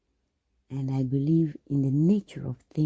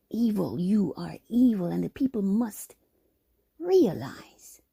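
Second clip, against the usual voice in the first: rising rant; 7 semitones higher than usual. Acted. Ranting accusation building up.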